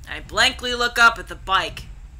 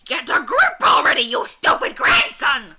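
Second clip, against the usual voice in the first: put-on raspy, scolding old granny shout; harsh granny bellow; yelling granny; 4 semitones higher than usual. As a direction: yell at a grandson like a cranky grandmother. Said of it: "where does she get that loud voice from?".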